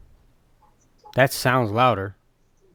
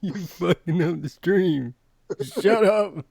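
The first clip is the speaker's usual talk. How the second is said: laughing; 5 semitones higher, slower than usual. Real, not acted. Still laughing at echo.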